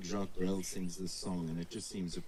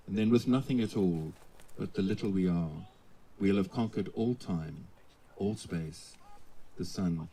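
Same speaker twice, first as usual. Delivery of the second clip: poem recitation; 9 dB louder than usual. Acted. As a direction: declaim it like a ceremony poem. Officiant recites song lyrics.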